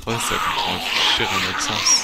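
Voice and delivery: stressed out, trapped in the bus; distressed. Real, not acted. Gamer trapped by zombies telling viewers, loud distress.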